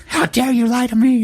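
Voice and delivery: high-pitched mock indignation with a gasp; gasp, then imitate her scandalised outburst; mock offended outrage. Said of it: "And she got all mad".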